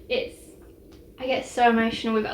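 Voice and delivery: usual speaking voice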